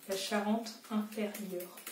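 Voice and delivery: Charente-Inférieure drawn out slowly and softly; slow careful checking. Real, not acted. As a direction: sound out Charente-Inférieure slowly as you look it up.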